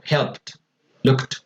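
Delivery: HELPED, LOOKED said "helpt, lookt". Shown as a demonstration. Teacher recaps the rule, HELPED and LOOKED ending in T. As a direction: end HELPED and LOOKED on a clear T. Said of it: "la E D suena como una T de tetero".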